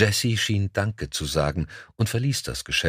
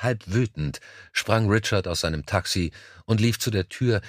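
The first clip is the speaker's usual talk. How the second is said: ordinary delivery, nothing marked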